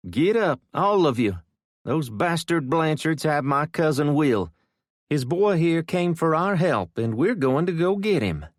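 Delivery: high, loud rallying order; rally the whole room to act; rousing command. Acted. Gabby ordering the sleepers up to rescue Will, high and loud.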